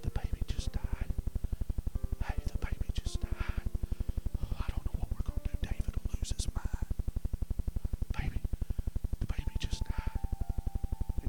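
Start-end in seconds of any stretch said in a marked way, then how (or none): 0.0-10.2 hushed, voiceless whisper; secretive stage whisper. Acted. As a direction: whisper the bad news so he won't overhear. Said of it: "And they're whispering".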